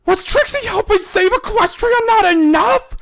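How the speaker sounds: high, loud indignant shout; angry retort. Acted. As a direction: fire it back, fed up. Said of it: "Trixie angrily retorted".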